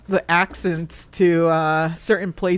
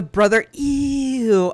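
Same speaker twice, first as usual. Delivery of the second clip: drawn-out higher "ew" on "brother ew"; imitated meme disgust; 6 semitones higher, much slower than usual. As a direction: stretch "ew" in mock disgust. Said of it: "Oh, that meme".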